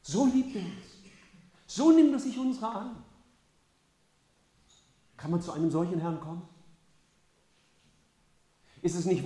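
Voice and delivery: high, loud, swelling proclamation; fervent preaching. Acted. Preacher calling the church to Jesus, fervent high preaching. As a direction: lift the hall with his love.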